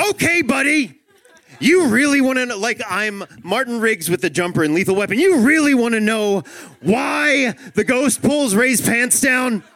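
loud, high, mock-furious outburst; dad at breaking point. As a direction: stretch the key words into a manic yell. Dad snaps at endless whys.

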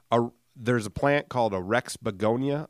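ordinary delivery

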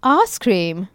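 low posh English voice; posh English accent. Shown as a demonstration. Host repeating the accent hack, posh English accent. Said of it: "in a posh English accent".

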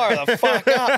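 high, slow, hammed-up drinking chant; rowdy cheer. Urging grandad to drink, hammed-up high cheer. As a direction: egg grandad on like a pub crowd.

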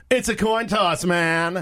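high-pitched impression of an absent colleague; Shane. Co-host imitating Shane for the other host, high-pitched impression. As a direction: raise the pitch well up, mimicking someone's pet line. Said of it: "that was my Shane impression".

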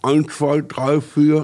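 counting with a cork between teeth; cork in mouth. Shown as a demonstration. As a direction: speak around a cork in the mouth. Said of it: "Korken in den Mund stecken".

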